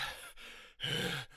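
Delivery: imitated hard exhale; heavy breathing. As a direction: puff out a heavy, voiceless breath. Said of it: "he's breathing hard".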